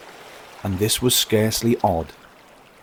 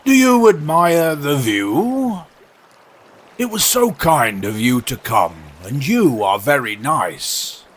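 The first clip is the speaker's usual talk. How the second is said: courteous walrus; 5 semitones higher, 5 dB louder than usual. Acted. Walrus politely distracting the oysters, mildly pompous.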